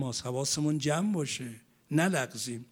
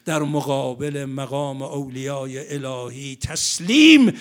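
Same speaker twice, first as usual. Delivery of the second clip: loud, ringing exhortation; full-force preaching; 8 dB louder than usual. Acted. Preacher exhorting the men and women of the congregation, loud and ringing. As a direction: rouse the congregation with every phrase.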